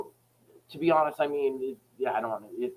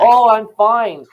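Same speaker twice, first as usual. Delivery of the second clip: high, loud, drawn-out mock cheeriness; exaggerated phone-script politeness; mock pleasantry; 8 semitones higher, 10 dB louder, much slower than usual. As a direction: stretch it out, sending up the scripted caller. Mimics callers' scripted pleasantry.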